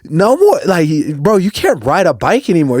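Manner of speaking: high, insistent order; emphatic. Retold or imitated. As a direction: insist he gives up riding. Forbidding brother to ride.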